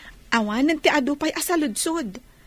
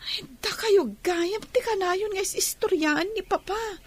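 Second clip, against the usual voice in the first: ordinary talk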